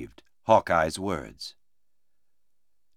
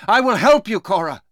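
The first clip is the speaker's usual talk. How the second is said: high, loud promise of rescue; shouting; 11 semitones higher, 5 dB louder than usual. Acted. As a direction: call out loudly to reassure her. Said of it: "shouted Duncan".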